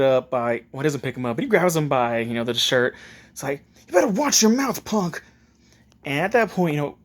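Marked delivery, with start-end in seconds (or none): 3.9-5.2 raised, high-pitched threat; hot-tempered warning. Acted. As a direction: warn him with a flash of temper. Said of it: "has a bit of his mother's temper in him".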